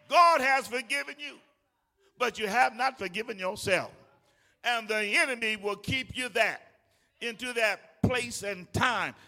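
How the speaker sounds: loud, fervent sermon; building preacher's shout; preaching at full force. Real, not acted. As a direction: drive it home to the congregation.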